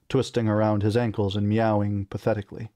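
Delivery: usual speaking voice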